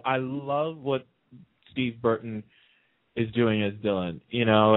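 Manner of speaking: ordinary talk, nothing marked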